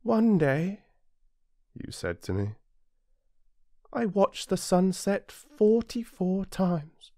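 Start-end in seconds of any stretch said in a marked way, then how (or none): 0.0-0.8 put-on high, light boyish voice, eager; a small prince asking his friend eagerly; little prince. Narrator voices the little prince, high and eager. 3.9-7.1 put-on high, slow boyish voice, wistful; a lonely small prince tells his friend slowly; little prince, wistful. Narrator voices the little prince, high, slow and wistful.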